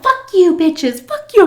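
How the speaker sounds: high, loud mock yelling while dragged out; retold drunk yelling at the strippers, high and loud; drunken shouting. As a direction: scream insults over your shoulder. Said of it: "I'm all talking shit".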